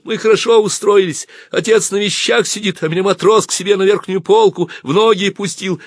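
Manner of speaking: put-on high, loud boyish chatter; high-voiced, eager shouting; excited boy calling. A narrator's reading. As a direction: call to a friend from a train window. Boy calls out of train window.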